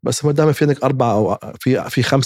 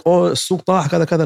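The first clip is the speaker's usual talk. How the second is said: mock panic; 6 semitones higher than usual. Mocking panicked investor.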